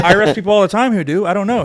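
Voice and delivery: high, loud retold protest; indignant denial. Officer telling the interrogator he arrests such people, indignant.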